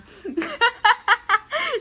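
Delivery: high, loud laughter. Real, not acted. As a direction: crack up. Big laugh.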